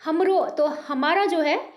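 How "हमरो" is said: हमरो with a rounded Bengali o, said higher and louder; Bengali लहजे में हमरो; Bengali-style हमरो. Shown as a demonstration. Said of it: "Bengali में हमरो बोलते हैं".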